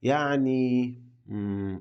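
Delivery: hesitant drawn-out pause while thinking; thinking filler. Shown as a demonstration. Teacher shows how learners pause to think, hesitant filler. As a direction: pretend to search for the next word. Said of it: "تسكت في كلامك وتقول".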